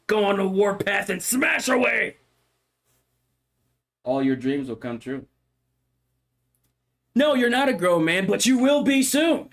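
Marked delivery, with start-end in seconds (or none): 0.1-2.2 rising battle cry. Acted. Streamer rallying viewers to kill bugs, rising battle cry. 7.1-9.5 mock pep talk.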